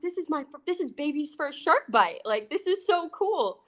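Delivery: baby's first shark bite, retold with giddy glee; giddy excitement. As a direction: gush like it's your birthday. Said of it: "And I was freaking out".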